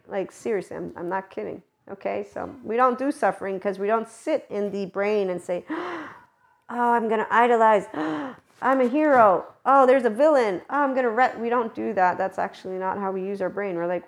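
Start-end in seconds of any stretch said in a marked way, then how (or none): 5.7-11.4 gasping, higher, louder hammed-up hero-and-villain drama; a gasping mock melodrama, higher and louder; mock dramatic inner voice. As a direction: ham up a brain that loves drama, with gasps. Mocking the dramatizing brain's voice.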